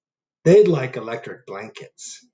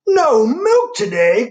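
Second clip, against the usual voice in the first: loud, higher, slow wail of dismay; crying out; 12 semitones higher, 9 dB louder, slower than usual. Acted. Farmer Brown crying out over the empty barn. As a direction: cry it out in dismay. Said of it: "cried Farmer Brown".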